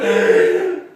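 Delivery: high, loud drawn-in gasp; over-the-top acted gasp; terrified gasp. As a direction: gasp hugely, face to face with danger. Dog right in guard's face.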